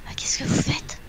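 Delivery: whispered, startled question; in-character whisper. Acted. Player's character whispers at the others in the corridor, whispering. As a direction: ask it in a hushed whisper.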